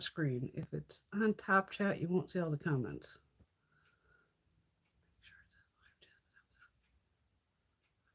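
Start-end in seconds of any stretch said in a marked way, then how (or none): 5.1-8.1 barely audible, slow whisper; slow whisper to oneself; whispered reading. Real, not acted. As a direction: read it under your breath to yourself. Reading chat instruction to herself.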